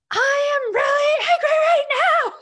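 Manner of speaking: full-force, high-pitched shout; reenacted furious yell; angry yell. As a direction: yell the feeling out loud, every word sustained. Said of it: "So I yelled".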